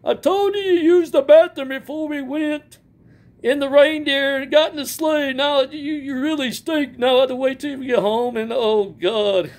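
high, hammed-up jolly Santa. Animatronic Santa greets the fans in a high jolly voice. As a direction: boom out ho-ho-ho like a toy Santa.

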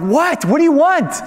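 high, loud mock holler; exasperated high-pitched retold shout; yelling back. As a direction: yell back as if you can't hear them. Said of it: "she doesn't have to scream".

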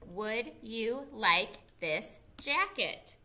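teacher reading along slowly with the class; slow choral reading. Shown as a demonstration. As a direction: read it slowly together. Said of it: "Can you read it with me?".

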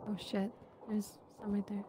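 hushed whisper. Real, not acted. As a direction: whisper it so nothing hears. Hiding from a monster.

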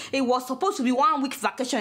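normal talking voice

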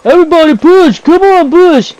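high-pitched mock rallying yell; imitated yelling. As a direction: imitate the pushy guy in chat. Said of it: "He's yelling".

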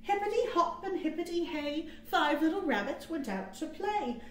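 ordinary delivery, nothing marked

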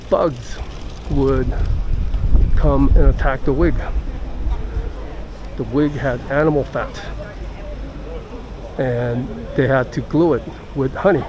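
ordinary delivery

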